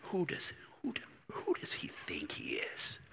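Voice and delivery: hushed whisper, barely voiced; whispering crowd. Preacher voicing whispering synagogue crowd, hushed. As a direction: whisper it like gossip in the pews. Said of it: "you see the whispers".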